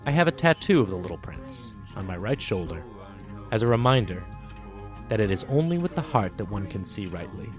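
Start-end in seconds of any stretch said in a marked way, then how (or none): none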